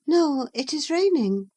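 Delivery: put-on high, lively young girl's voice; Catherine's voice. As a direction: raise the pitch for the young heroine.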